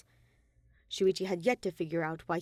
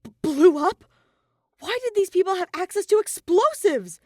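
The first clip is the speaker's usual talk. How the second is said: much higher and louder stammering alarm; shocked stammer; 12 semitones higher, 11 dB louder than usual. A narrator's reading. Shuichi alarmed at Monokuma's news, stammering high. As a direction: stumble over the words in alarm. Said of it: "almost tripped over his own feet".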